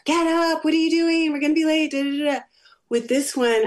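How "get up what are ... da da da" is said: high-pitched mock yell, no real volume; retold yelling. Mother hurrying her late daughter for school, mock yelling. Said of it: "I would yell at her".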